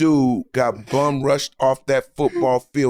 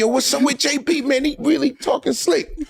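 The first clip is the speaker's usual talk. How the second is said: mock gossip; 11 semitones higher than usual. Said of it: "everybody kept saying".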